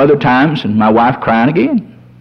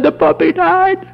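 much higher, imitating a crying wife; mock tearful wail; 13 semitones higher than usual. Crying wife tells husband about the puppy, mock tearful. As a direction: wail it high, pretending to cry. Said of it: "she was crying her eyes out".

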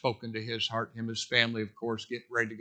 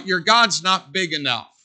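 louder scoffing taunt; mocking doubter; 4 semitones higher, 8 dB louder than usual. Preacher voicing doubters who scoff at God, loud sneer. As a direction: sneer it like a skeptic. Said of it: "what they're saying is this".

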